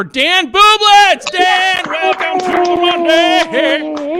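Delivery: very high, loud emcee yell, last words stretched; announcer shout. Real, not acted. Host brings Dan on for his set, announcer shout. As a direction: holler the act onto the stage, drawing out the welcome.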